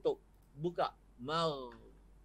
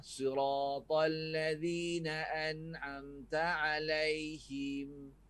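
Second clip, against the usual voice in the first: siratal ladzina an'amta alaihim drawn out, slow; slow Quranic recitation; much slower than usual. Shown as a demonstration. Ustaz reciting the verse slowly as a model for the class. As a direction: chant the verse slowly with each letter placed. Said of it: "Ada ham".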